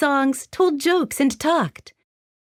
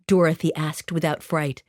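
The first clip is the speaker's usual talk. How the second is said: usual speaking voice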